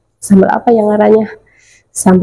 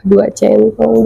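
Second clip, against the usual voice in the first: usual speaking voice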